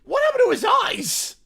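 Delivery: loud startled question; surprised shout. Real, not acted. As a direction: blurt it out in shock. Shocked shout at video.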